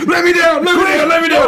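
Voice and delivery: high, loud, frantic begging; desperate pleading. Pretend. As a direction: beg them to let you down. Turning werewolf begs to be tied.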